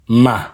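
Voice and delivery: Ma sung out on a high level pitch. Shown as a demonstration. Teacher models a high tone for learners: Ma high. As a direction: hold Ma up high, same loudness. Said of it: "Listen to the high tone".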